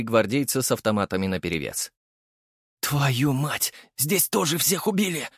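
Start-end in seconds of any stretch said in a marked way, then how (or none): none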